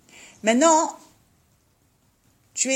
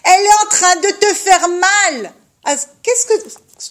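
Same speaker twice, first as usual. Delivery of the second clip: emphatic exhortation; 8 semitones higher, 11 dB louder than usual. Real, not acted. Teacher exhorts her listeners.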